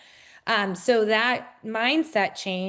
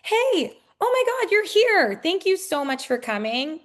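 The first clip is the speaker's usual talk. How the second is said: high, bright-voiced gush of welcome; over-the-top hostess welcome; excited greeting; 8 semitones higher, 5 dB louder than usual. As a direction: greet them like a long-lost friend, much higher. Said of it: "I love being like".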